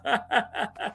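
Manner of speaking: soft fading laugh; quiet leftover chuckle; trailing laugh. Real, not acted. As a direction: let the laugh die off. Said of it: "it made me laugh".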